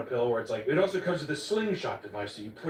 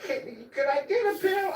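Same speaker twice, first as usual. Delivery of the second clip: lightly voiced old woman, much higher; high old lady voice; 12 semitones higher than usual. Imitating elderly coworker Gladys asking a doctor for a pill, high mock voice. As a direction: squeak it out like a sweet old coworker. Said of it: "she's just like".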